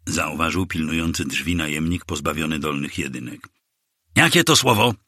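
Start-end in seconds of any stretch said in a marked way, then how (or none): 4.1-4.9 higher-pitched demanding yell, moderately raised; narrated yell, kept in check. A narrator's reading. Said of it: "wrzasnął kapelusznik".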